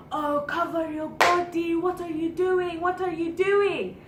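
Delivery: drawn-out, high-pitched mock yell; imitated man yelling. Friend imitating a stranger scolding her on the street, mock yelling. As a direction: stretch each word like a scolding man calling out. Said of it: "like, yelling, like, grown man yelling".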